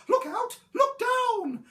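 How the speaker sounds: very high, loud hoot; high yelling duckbill; yelled warning. A narrator's reading. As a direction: shriek the warning out. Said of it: "who yelled".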